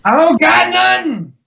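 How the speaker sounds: very high, loud caricatured denial; mock protest. Bantering denial in the stranger's manner, high mock protest. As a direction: yelp it high in jokey protest. Riffing in stranger's broken English.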